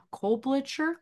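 ordinary talk

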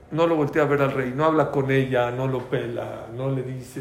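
ordinary talk

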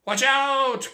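loud, high warning cry; urgent shouted warning. Acted. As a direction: yell to save him from the charge. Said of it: "cried Jack".

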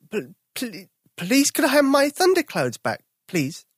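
put-on a nervous boy forcing out his request; croaking, scared. Tom pleads with fierce bears, stammering fear. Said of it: "His voice croaked".

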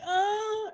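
high piercing faked cry of pain; mock porn shriek. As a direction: shriek it like a hammed-up porn actress. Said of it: "She's like".

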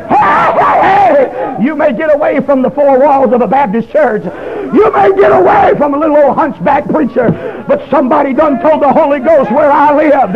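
full-force revival preaching. Real, not acted. Preacher tells church the Holy Ghost followed him home, shouting triumphantly. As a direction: preach it at the top of your voice, stretching the climax.

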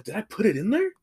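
mock confusion, rising at the end; puzzled self-questioning. Man doubting himself at the empty fridge, puzzled.

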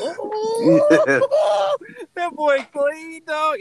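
high, loud excited whoop; real excited whoop; hyped. Real, not acted. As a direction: whoop with delight at the song. Hyped about walkout song.

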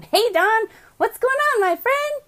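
high, bright call to a friend; delighted hello to a friend; excited greeting. Real, not acted. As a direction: call out happily to a newcomer. Greeting a viewer joining live.

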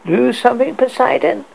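high, taunting witch voice; spiteful. A narrator's reading. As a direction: sneer it spitefully. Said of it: "said the witch with a spiteful hotness".

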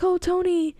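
mock worried wife waking him; imitating Pepper. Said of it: "Pepper's like".

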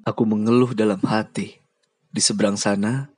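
ordinary delivery, nothing marked

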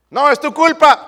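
high, loud blaming shout; mock quarrel. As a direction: play a spouse snapping back in a fight. Acted couple's quarrel.

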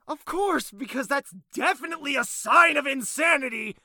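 high, loud sarcastic retort. Acted. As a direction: lay the sarcasm on thick.

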